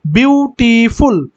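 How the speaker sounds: বিউটিফুল slowly in three drawn-out beats, বিউ-টি-ফুল; syllable by syllable. Shown as a demonstration. Teacher showing that বিউটিফুল has three beats, slow and syllable by syllable. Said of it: "তিনবার".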